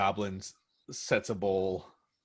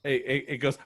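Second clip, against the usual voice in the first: ordinary talk, nothing marked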